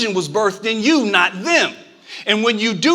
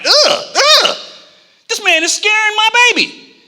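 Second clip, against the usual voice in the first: high, loud squeal of fake horror; hammed-up scared dad shrieking; mock fright; 11 semitones higher, 4 dB louder than usual. A dad spooked by a stranger squeals in hammed-up fright.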